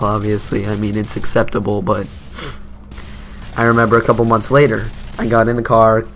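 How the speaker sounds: usual speaking voice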